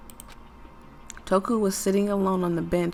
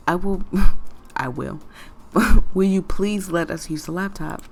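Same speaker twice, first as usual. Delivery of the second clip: laughing; 8 dB louder than usual. Acted.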